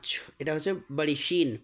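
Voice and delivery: ordinary talk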